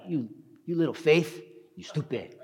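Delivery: mock scolding, kept soft; scolding, the wrong way. Shown as a demonstration. Jesus wrongly imagined berating Peter, mock scolding. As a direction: play at berating him, half-hearted. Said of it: "Not like".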